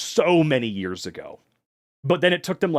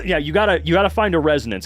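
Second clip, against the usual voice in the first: usual speaking voice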